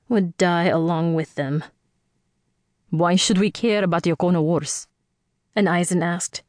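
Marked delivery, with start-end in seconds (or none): 2.9-4.9 put-on quick, confident Indian accent; a young man challenging her, in an Indian accent. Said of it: "his voice rich with an Indian accent".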